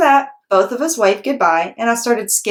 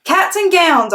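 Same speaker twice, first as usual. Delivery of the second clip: high, loud holler; 10 semitones higher, 3 dB louder than usual. Acted. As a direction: holler it with joy. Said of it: "I hollered".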